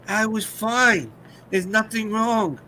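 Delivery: high, slow mock protest; quoting a comic's wronged-man bit; imitated comedian. Quoting a stand-up routine.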